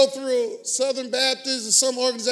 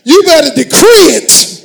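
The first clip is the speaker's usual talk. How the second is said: preach; 3 semitones higher, 18 dB louder, slower than usual. Acted. Preacher at full force.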